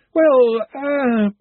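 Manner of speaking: hesitant Ponder. A narrator's reading.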